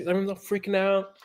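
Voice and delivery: ordinary delivery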